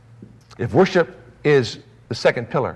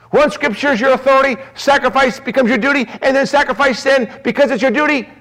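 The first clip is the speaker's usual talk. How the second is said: high, louder preacher's build; rising preaching; 13 semitones higher, 5 dB louder than usual. Acted. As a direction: drive the principle home from the pulpit.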